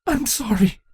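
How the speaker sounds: choked. A narrator's reading. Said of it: "managed to choke out".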